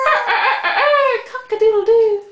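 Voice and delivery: high laughing rooster crow. Shown as a demonstration. Imitating a rooster.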